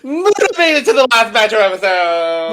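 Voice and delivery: high and loud, full of hype; hyped. Real, not acted. Host celebrating the favorite's survival, loud and high. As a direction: announce it like a victory. Hyped announcement over music.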